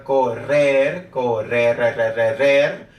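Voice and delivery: "correr" slowly with a strong trilled rr, then "re, re, re" drilled; rolled R. Shown as a demonstration. Teacher drilling learners on the R, "correr, re, re" with trilled rr. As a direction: roll the rr hard in "correr" and each "re". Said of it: "We roll it".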